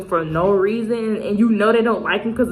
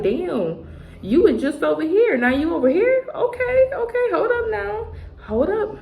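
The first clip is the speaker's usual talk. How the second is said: amazed double take; 8 semitones higher, slower than usual. Retold or imitated. People seeing you rise.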